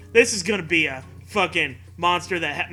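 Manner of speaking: normal talking voice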